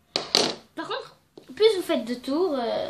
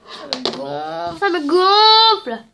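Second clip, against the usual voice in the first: slow, building to a loud exasperated groan; fed up with her cold, fed-up groan; 3 semitones higher, 8 dB louder, much slower than usual. Real, not acted. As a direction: drag it out, then blow up, sick of the cold. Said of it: "je suis enrhumée".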